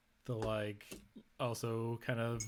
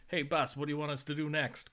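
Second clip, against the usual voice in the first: put-on gangster New York accent, fast and a bit higher; bad New York accent; 4 semitones higher, faster than usual. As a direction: play a tough-guy New York goon. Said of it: "Complete with a bad New York accent".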